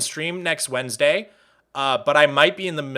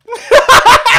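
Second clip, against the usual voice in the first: loud, piercing laugh, far above usual voice; high-pitched cackle; 13 dB louder than usual. Real, not acted. Cracking up at chat's reaction.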